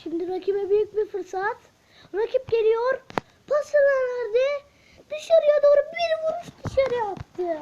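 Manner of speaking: loud, high, breathless match commentary; screaming football commentator. Acted. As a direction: call the goal like a live broadcaster. Commentates his own match replay.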